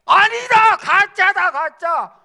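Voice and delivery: high, loud alarmed cry; enemy soldiers shouting. Acted. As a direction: yell that he's a fake.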